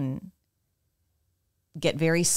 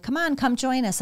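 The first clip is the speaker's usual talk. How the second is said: family calling her; 9 semitones higher than usual. Retold or imitated. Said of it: "you're like".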